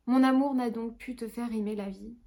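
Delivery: mildly anguished poetic exclamation; crying out. Acted. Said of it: "enfin, elle s'écrie".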